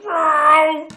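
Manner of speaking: loud, high car whoosh; shouted engine vroom; roaring engine noise. Retold or imitated. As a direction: imitate a car tearing by. Imitating cars speeding past.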